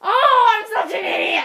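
loud, high exclamation; sudden high wail of realization; self-reproaching outcry. Real, not acted. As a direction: cry out at your own blunder. Realizes own mistake, exclaims.

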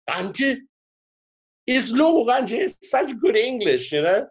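put-on little kids. Parent imitating Zulu kids calling her auntie, high and childlike. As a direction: pipe up like an excited small child. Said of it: "the little Zulu kids are so English".

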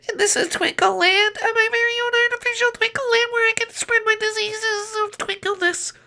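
very high, squeaky cartoon wizard; giddy falsetto character; gleeful little wizard. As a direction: squeal with delight in a tiny voice.

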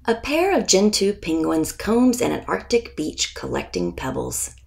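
plain reading voice

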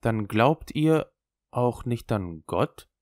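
slow, faltering question; halting. Acted. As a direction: get the words out haltingly. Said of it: "fragte stockend".

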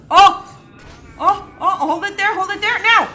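loud, high, excited commands; urgent shout. Real, not acted. Player telling partner when to shoot, urgent shout. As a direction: call the timing out urgently.

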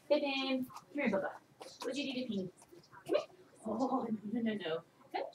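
quiet calling to a pet, half under breath; soft coaxing call. Real, not acted. Owner softly calling her ferret to come. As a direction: coax the ferret out gently.

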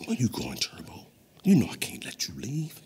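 low, threatening whisper; menacing whisper. Acted. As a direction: warn him softly, no escape. Stopping his friend from leaving.

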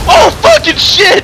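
startled yelling curse; shouted curse. Real, not acted. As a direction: shout it out in alarm. Crash while driving, shouting.

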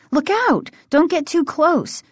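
high-pitched cry of caution; urgent warning. A narrator's reading. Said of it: "she warned".

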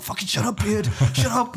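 high-pitched imitated yell; annoyed retort. Annoyed friends' retort, acted out.

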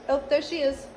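ordinary delivery

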